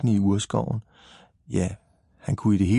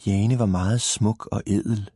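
usual speaking voice

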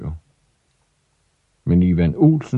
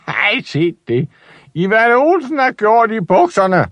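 high mocking shout, rising to the end; jeering big boys shouting; 13 semitones higher than usual. Big boys shouting at Ivan, high jeering yell. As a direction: yell it out to shame him. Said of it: "råbte de store drenge med deres dybe, rustne stemmer".

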